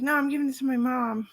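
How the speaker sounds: put-on kid insisting. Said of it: "And he's like".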